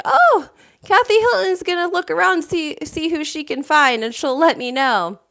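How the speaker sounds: high-pitched imitation of surprise, easing down; mock shock. [name] reacting to Kathy's matchmaking offer, mock shocked. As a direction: act taken aback, pitched well up at first.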